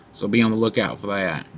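talking around pipe stem; pipe in mouth. Real, not acted. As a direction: lips on pipe. Seen 1: Pipe stem held at his lips while he speaks.

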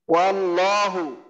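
slow ওয়াল্লাহু with a clear wa and a heavy, doubled lam; careful slow ওয়াল্লাহু; correct recitation. Shown as a demonstration. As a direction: stretch out ওয়াল্লাহু, landing on a thick lam. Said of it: "এই উচ্চারণটা হবে".

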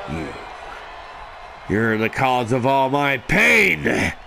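normal talking voice

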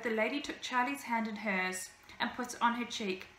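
ordinary delivery, nothing marked

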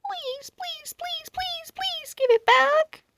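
high, pleading puppy whine; begging. Acted. Pug begs Nuts to return the wing, high pleading whine. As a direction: beg sweetly like a dog after a treat. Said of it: "I used my famous begging face".

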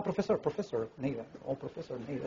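imitating students. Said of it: "decía".

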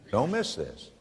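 normal talking voice

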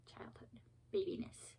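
whispered, trailing off; soft whispered afterthought. Real, not acted. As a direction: breathe it out softly.

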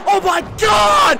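high, shouted disbelief; shocked shout. Real, not acted. Commentator reacts to a power slam, shouting in shock. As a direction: cry out at the impact.

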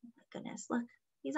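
breathy hushed whisper. Real, not acted. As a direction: whisper it in awe. Hushed aside to children.

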